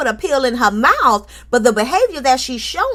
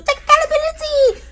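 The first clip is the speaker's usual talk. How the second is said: high squeal. Real, not acted. Host mocking Tisha, squealing high. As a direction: jump way up on accountability. Peak of the scolding.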